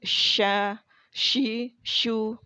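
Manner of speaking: sha, shi, shu pushed with extra air; blown shin. Shown as a demonstration. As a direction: blow harder through the sh. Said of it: "ditiupkan lagi".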